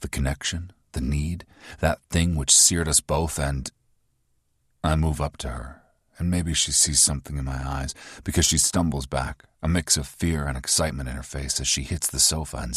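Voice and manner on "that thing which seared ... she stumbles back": usual speaking voice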